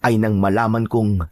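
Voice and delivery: ordinary talk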